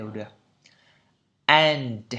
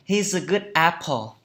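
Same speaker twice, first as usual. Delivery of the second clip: slow model sentence; 3 semitones higher, 4 dB louder, slower than usual. Shown as a demonstration. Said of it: "Anh ấy là một chàng trai tốt".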